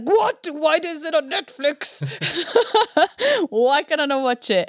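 high, loud outraged "what?" with a laugh; indignant disbelief. Retold or imitated. Mock outrage at the show not being on the streaming service. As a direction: play up the shock that it's missing. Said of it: "I was like".

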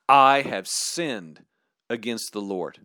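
king admitting his sin to the prophet; humble confession. Acted. David's confession.

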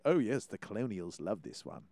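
low, soft mock-British voice; British accent, dropped low and quiet. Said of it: "Oh, digging that British accent".

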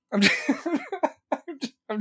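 high-pitched giggling through the words; cracking up mid-sentence; laughing. Real, not acted. Laughing at his own joke about Dina's face, cracking up.

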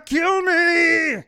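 very high, loud shouted taunt; Arnold shout. Retold or imitated. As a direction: scream it all out like Arnold. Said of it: "a lot of Arnold quotes".

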